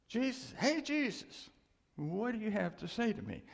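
high, pleading call to Jesus; mock plea to Jesus. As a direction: pipe up high like a kid begging for an answer. Asking Jesus for an answer.

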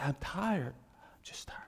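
worn-out sigh fading into a whisper; acted exhaustion. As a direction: let the voice give out, as if too tired. Preacher acts out being worn out.

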